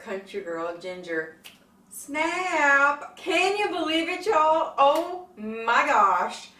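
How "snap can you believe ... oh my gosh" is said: high, loud, gushing excitement; thrilled. Real, not acted. Vlogger announcing her favorite collab partner, thrilled. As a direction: belt it out, stretching the big words.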